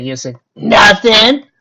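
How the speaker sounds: loud, high nervous blurt. A narrator's reading. Three fillies answer Applejack's question, blurting nervously. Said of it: "in nervousness".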